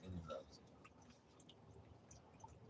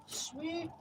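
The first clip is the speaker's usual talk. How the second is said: squeaky high. Acted. Talking to his cat Romeo.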